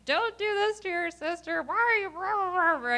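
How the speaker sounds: high, hammed-up yelling at the kids; mock yelling mom. Mom charging in, yelling at her older daughter, mock high yell. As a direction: shriek at the older child in jest. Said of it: "I come charging in, yelling and screaming".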